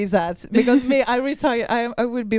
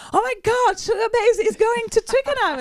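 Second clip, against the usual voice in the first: excited gush; 14 semitones higher than usual. Retold or imitated. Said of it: "and she said".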